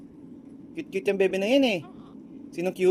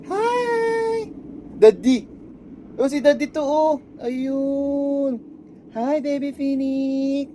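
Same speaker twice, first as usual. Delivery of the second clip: cooing greeting; 7 semitones higher, much slower than usual. Real, not acted. Dad saying hi to baby, high cooing.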